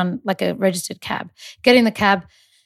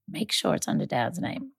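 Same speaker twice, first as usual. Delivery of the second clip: put-on whispered tip from a child; 3 semitones lower, 10 dB quieter than usual. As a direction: lean in and whisper it. Nine-year-old daughter whispering advice.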